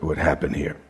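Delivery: ordinary talk, nothing marked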